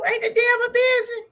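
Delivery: high, ringing church call-out; high rhetorical amen-style question; preacher-style exclamation. Real, not acted.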